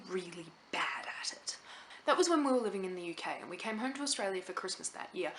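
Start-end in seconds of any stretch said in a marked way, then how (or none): 0.1-1.6 whispered embarrassed aside; whispering. Real, not acted. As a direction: drop to a sheepish whisper. Embarrassed aside about being bad.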